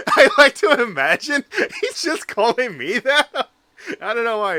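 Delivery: loud, high, laughing through the words; real laughter bursting into a squeal; helpless laughing. Real, not acted. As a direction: crack up while trying to talk. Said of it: "it just makes me laugh".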